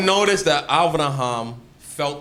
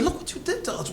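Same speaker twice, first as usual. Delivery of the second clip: "Look what you did to us" said much higher, as a hurt king; indignant king; 14 semitones higher, 6 dB quieter, faster than usual. Retold or imitated. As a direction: play it as a wounded, high-pitched king scolding. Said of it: "He said".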